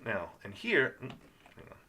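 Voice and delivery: ordinary talk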